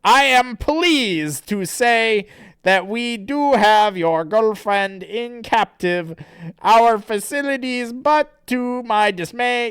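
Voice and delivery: slow, high, loud pompous villain; Nate. Acted. Villain announces the captive girlfriend, slow and pompous. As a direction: announce it with drawn-out theatrical grandeur. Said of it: "Nate person says".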